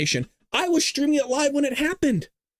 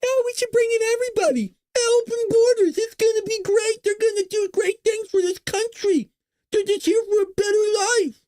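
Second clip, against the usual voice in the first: much higher, mock-naive open-borders voice; mocking Democrats; 11 semitones higher than usual. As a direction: parody a gushing naive liberal. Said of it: "And Democrats are going".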